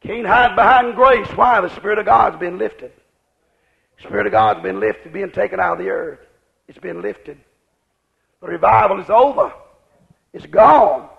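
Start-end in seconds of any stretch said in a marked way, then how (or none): 1.6-11.1 a mournful preacher fading to near silence; grave, hushed preaching lament; somber lament. Acted. Mourning the lifted Spirit.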